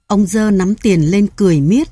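usual speaking voice

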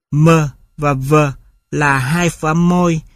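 normal talking voice

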